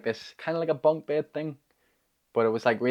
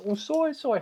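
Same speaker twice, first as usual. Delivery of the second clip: sudden apology; 14 semitones higher than usual. Retold or imitated. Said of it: "immediately I started saying".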